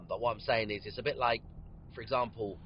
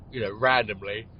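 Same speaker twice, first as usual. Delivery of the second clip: yawning; 8 dB louder than usual. Real, not acted. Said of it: "I always yawn when I'm podcasting".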